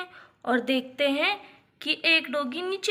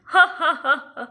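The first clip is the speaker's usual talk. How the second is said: laughing; 8 dB louder than usual. A narrator's reading. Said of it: "दोनों हंसने लगते हैं".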